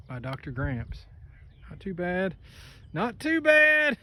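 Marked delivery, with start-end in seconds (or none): 2.9-4.0 high, slow, drawn-out "Not too bad"; sing-song drawl. Shown as a demonstration. Same words said twice.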